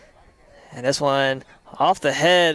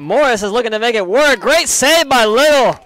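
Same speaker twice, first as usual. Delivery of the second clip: raised, louder call climbing to the save; excited play-by-play; 7 semitones higher, 7 dB louder than usual. Real, not acted. Commentator calling a great save by Little, excited and loud. Live call of a near goal.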